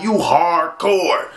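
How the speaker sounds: slow, drawn-out mocking; mock tough. Mocking a man who feels tough with a pistol, drawn out. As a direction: stretch it out with sarcasm.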